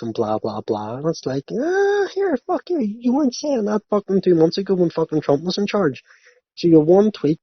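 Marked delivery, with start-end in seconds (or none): 1.5-6.0 high-pitched, exaggerated protest; hammed-up indignation; mock indignant retort. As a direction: call out the hypocrisy in a high voice. Mock retort to tweeter's change of mind.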